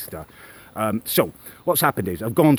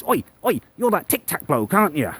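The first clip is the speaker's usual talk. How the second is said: high, jeering imitation of a brash woman; a hammed-up mouthy woman calling out across the shop; mocking woman's voice; 8 semitones higher than usual. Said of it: "and she goes".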